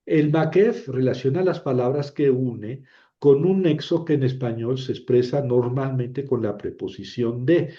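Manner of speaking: ordinary talk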